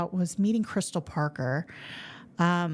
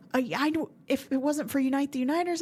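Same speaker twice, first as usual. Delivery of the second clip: ordinary delivery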